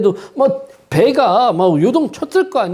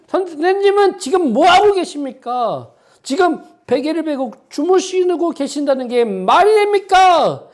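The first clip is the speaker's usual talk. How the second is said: fiery preaching; 10 semitones higher than usual. Acted. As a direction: press the congregation with the drowning men's plea. Preaches the disciples' plea. (높고 힘찬 설교조의 호소)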